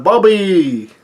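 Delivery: high, drawn-out mock yell; retold yell. Pound yells out for Bobby, drawn-out mock yell. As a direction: call the name out long and high. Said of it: "Pound yells out".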